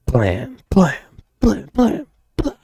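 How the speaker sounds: high-pitched blams; quick run of high mock gunshots; voiced gunshot effect. Reader sounding out the shooting, high-pitched blam effects.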